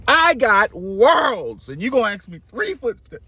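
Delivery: high, incredulous outburst; offended king. Acted. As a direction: draw it out high in mock affront. Bali insulted by the small request.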